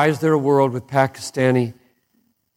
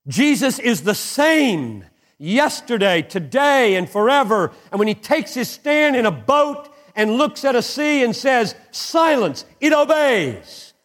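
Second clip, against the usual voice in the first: higher, soaring proclamation; full-force preaching; 9 semitones higher than usual. Acted. Pastor proclaiming Jesus stilling the sea, soaring preaching. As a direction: proclaim it climbing to silence.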